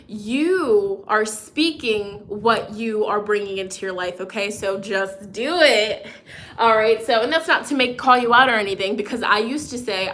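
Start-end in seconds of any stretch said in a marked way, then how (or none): none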